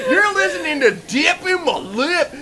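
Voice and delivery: loud, drawn-out country DJ shouting. Acted. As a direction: hype it like a backwoods radio ad. Hick radio ad parody.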